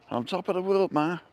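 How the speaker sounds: hint of the film gangster calling out; Cagney quote. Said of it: "James Cagney".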